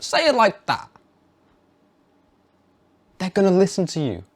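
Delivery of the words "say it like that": ordinary talk, nothing marked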